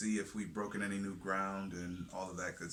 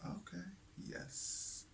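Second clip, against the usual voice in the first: whispered; 11 semitones higher, 9 dB quieter, much slower than usual. Real, not acted. Soft mock agreement.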